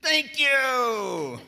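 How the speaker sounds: high, loud, drawn-out cheer; big high-pitched thank-you; exuberant thanks. Real, not acted. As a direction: sing out the thanks with a long falling slide. Ends the chant, thanks the group.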